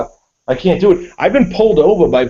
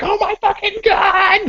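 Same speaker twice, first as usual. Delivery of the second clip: much higher, slow, drawn-out shriek of imitated terror; mock panicked wife; slower than usual. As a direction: do the wife's hammed-up panic in the rotary. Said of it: "she's crying".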